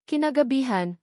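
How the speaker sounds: ordinary delivery, nothing marked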